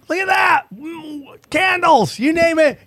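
high, bright-eyed gushing over crystals; excited. Acted. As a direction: gush like a shopper who found treasure.

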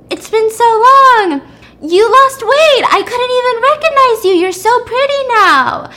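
very high, loud, slow gushing; gushing friend. Retold or imitated. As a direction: gush over her like an old friend. Coworker gushes.

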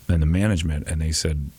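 normal talking voice

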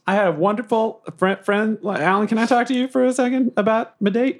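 awkward, stammering request; flustered Bobbert. Acted. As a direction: stumble over it, pressing for privacy. Flustered Bobbert seeks private talk.

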